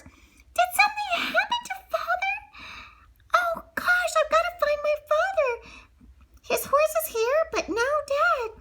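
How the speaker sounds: high, alarmed cries for her father; worried daughter. Acted. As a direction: panic rising, searching. Belle fears for her father.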